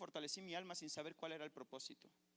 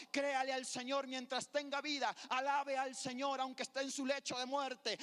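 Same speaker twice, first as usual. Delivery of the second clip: preaching at full force; 11 semitones higher, 10 dB louder than usual. Acted. Pastor exhorting the church to praise God, full-force preaching. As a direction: shout the praise to the whole church.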